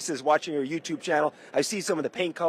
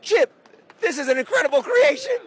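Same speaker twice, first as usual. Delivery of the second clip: thrilled; 12 semitones higher than usual. Real, not acted. Host gushes to the builder about his car, thrilled and high. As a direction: gush to the builder.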